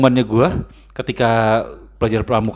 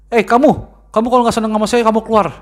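higher-pitched mock telling-off; imitated scolding lecturer; 8 semitones higher than usual. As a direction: act out a lecturer ordering a student out. Acting out lecturer ordering student out.

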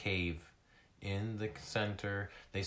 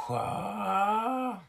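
high, loud drawn-out wail; 14 semitones higher, 8 dB louder than usual. Acted. A lost seal crying for help, high loud wail. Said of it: "froze at the wailing sound".

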